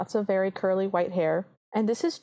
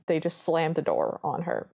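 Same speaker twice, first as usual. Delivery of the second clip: ordinary delivery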